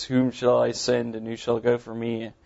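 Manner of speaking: usual speaking voice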